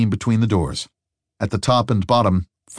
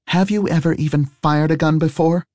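put-on young woman voice; 9 semitones higher than usual. Harry voiced by narrator.

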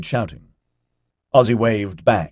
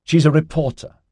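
higher-pitched, indignant protest; 9 semitones higher, 4 dB louder than usual. A narrator's reading. Mark objecting to Giselle about the reporter, protesting. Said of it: "Mark protested".

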